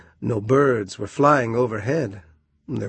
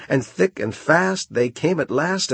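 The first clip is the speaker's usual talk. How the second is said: ordinary delivery, nothing marked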